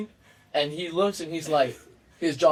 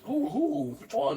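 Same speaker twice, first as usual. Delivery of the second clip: confused who; 13 semitones higher, 4 dB quieter, slower than usual. Retold or imitated. Buddy confused, looking for the guy.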